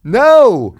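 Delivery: loud, high-pitched, incredulous no; emphatic shouted denial. Real, not acted. Comic correcting friends about the photo, shouting in disbelief. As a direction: shout down the mistake. Loudly denying it is Phil.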